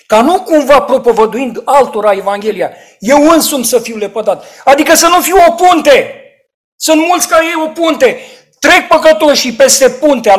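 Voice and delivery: loud, high sermon warning; rising preacher's rebuke; fiery preaching. Acted. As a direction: thunder it from the pulpit. Preaching at full force.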